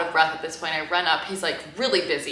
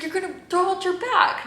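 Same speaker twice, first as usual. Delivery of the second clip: higher, teasing New York guy scolding her; New York delivery guy; 14 semitones higher, 4 dB louder than usual. Retold or imitated. Driver teasing her for carrying the box, high mock-scolding. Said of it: "a really thick New York accent".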